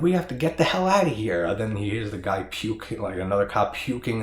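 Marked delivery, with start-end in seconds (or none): 0.0-1.5 high, loud frantic plea to leave; panicked cop. Retold or imitated. Spooked cop begging partners to flee, frantic and loud. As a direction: shout it like you're desperate to run. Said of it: "totally freaking out".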